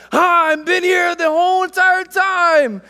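put-on high, loud child's announcement; imitating excited daughter. Daughter pops out from under the table, high mock-child shout. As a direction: burst out as a little girl revealing herself. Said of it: "out from under the table, she goes".

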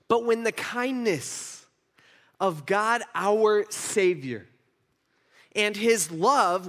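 slow, deliberate verse with long pauses; emphatic scripture reading. Real, not acted. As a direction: savour each phrase of the verse. Reads scripture aloud emphatically.

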